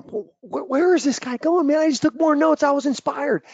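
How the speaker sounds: stammer, then burst out high and incredulous; acting out a bewildered audience member; high-pitched, worked-up disbelief; baffled protest. Acts out his baffled younger self.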